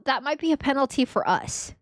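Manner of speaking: ordinary talk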